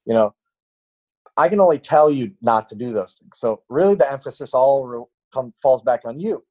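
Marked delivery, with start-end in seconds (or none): none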